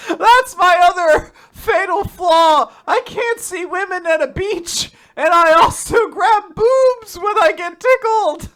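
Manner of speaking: loud hammed-up confession; mock lament. Playing the boy confessing loudly to friend. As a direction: wail the fatal flaw. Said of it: "mock confession".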